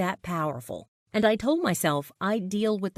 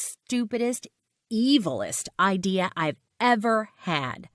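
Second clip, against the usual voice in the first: ordinary delivery, nothing marked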